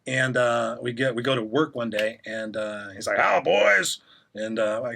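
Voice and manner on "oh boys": high, louder cheery greeting; lightly voiced cheerful older man; Norman's greeting. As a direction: sing out the welcome like the boss.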